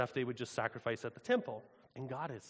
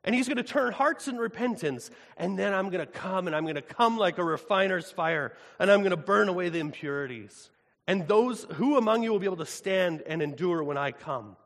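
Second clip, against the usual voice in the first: higher and louder solemn divine proclamation; proclaiming God; 4 semitones higher, 8 dB louder than usual. Acted. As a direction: announce it as God pronouncing judgment. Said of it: "He says".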